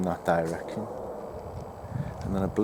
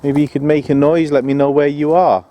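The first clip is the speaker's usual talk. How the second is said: loud, raised call across the woods; 4 semitones higher, 7 dB louder than usual. Real, not acted. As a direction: shout out to someone hidden, without anger. Calling out to unseen presence.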